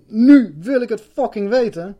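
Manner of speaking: loud, slow, forceful demand; a loud inner vow, slow and determined; inner shout. Retold or imitated. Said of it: "In mezelf dan".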